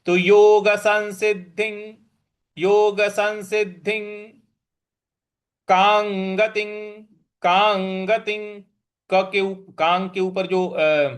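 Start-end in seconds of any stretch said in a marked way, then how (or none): 0.1-4.4 संसिद्धिं ending in ng, सं with n; anusvara as ng. Shown as a demonstration. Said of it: "अंग का उच्चारण यहाँ पे ले आएंगे". 5.7-8.6 कांगतिं with ng sounds, slow; anusvara as ng. Shown as a demonstration. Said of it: "अंग का ही उच्चारण ले आना है".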